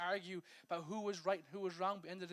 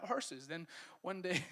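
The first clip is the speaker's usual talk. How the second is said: ordinary delivery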